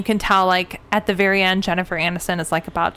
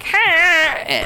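high, squeezed practice squeal; short piercing drone; imitated bagpipe squeal; 8 semitones higher than usual. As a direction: mimic a bagpipe heard across the street.

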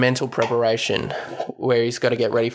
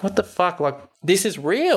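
high, disbelieving outburst; mock outrage at being laughed at; pitched-up what the fuck; incredulous; 7 semitones higher than usual. Rod in disbelief at police.

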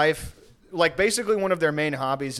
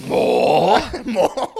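mock pleading orphan; 3 semitones higher, 6 dB louder than usual. Continuing Oliver begging.

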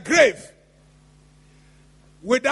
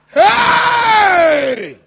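sharp shouted hey; preacher's shout; 5 semitones higher, 4 dB louder than usual. Acted. Preacher calling out to the congregation, a loud shout. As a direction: jolt the room with one call.

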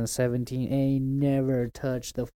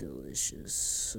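low slow savoring; 9 semitones lower, 7 dB quieter, much slower than usual. Pretend. Savoring the food talk.

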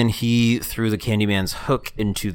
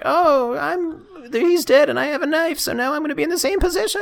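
high squeaky mock voice. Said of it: "she's like".

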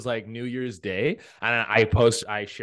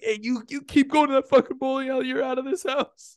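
mock angry family; 13 semitones higher, 3 dB louder than usual. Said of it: "They're like".

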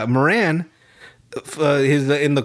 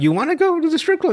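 put-on eager high girl voice; 13 semitones higher, faster than usual. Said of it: "she's like".

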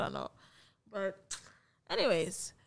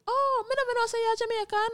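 much higher, imitated Jamaican patois; 11 semitones higher than usual. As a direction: mimic the old man's Jamaican patois surprise. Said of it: "he was just like".

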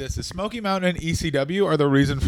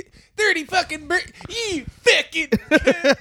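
high, slow furious swearing; Jim Cornette swearing tirade; 12 semitones higher than usual. Retold or imitated. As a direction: spit out the insult slowly. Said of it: "That's how you get into it".

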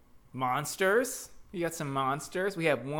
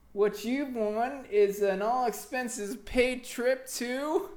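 game show host voice; booming prize announcer; 7 semitones higher than usual. Acted. Prize reveal to winner.

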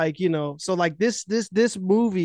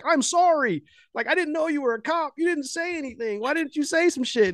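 much higher, panicky apology; frightened apology; 9 semitones higher than usual. Retold or imitated. Cabbie suddenly afraid of the cop, high panicky apology. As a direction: beg forgiveness in a high scared voice. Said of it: "he immediately freezes".

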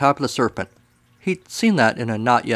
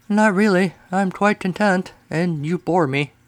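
bored serpent; 7 semitones higher than usual. Acted.

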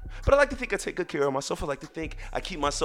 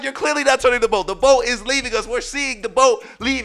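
high, loud exasperated argument; raised, high-pitched arguing; yelling back; 8 semitones higher, 5 dB louder than usual. Retold or imitated. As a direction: re-enact shouting at the helmsman. Swimmers yelling back in quarrel.

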